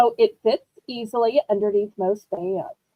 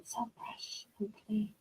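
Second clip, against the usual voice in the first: breathy soft whisper, savoring the phrase; hushed, dreamy whisper; 4 semitones lower, 20 dB quieter than usual. Shown as a demonstration. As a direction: whisper "So fresh and clean" like a dreamy ad. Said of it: "Doesn't it just sound good?".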